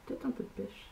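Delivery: breathy whisper under her breath; half-voiced musing whisper; whispered aside. Real, not acted. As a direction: whisper it to yourself, thinking aloud. Muttering to herself after swatching.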